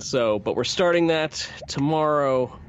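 normal talking voice

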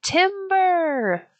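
very high, long call sliding down; storybook yell. A narrator's reading. Logger Pat warns of another falling tree, yelling high. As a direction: stretch it out like a far-carrying warning. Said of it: "when Pat yelled again".

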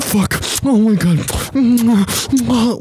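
loud, drawn-out high groan of disbelief; mock exasperation. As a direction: stretch it out in over-the-top dismay. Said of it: "I go".

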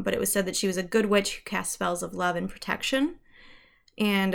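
usual speaking voice

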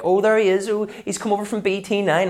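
high, loud teasing shop voice; high mocking voice of a West Belfast local; mock shopkeeper teasing. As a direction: mimic the locals jeering that he's posh. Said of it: "I'll be like".

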